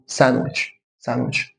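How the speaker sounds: quiet "san-witch" repeat; silent D. Shown as a demonstration. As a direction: say "ساندويتش" softly without the D. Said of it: "اكن الدي مش موجود".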